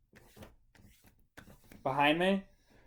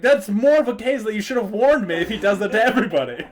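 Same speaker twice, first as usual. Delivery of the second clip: mock-indignant, laughing through it; laughing complaint; 6 semitones higher, 5 dB louder than usual. Telling Moose he should have warned him, laughing. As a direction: scold him while laughing.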